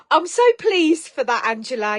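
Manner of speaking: loud, high burst of joy; thrilled for her; delighted. Real, not acted. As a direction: beam with pleasure. Delighted at viewer news.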